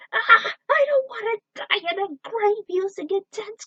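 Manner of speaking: very high, loud wail, gravity stretched out; terrified scream. Acted. Mutant screaming while thrown wall to wall, terrified. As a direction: scream for your life.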